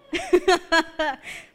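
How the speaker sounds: high, loud chuckle; amused laugh. Real, not acted. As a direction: laugh at your own silly story. Laughs at her own story.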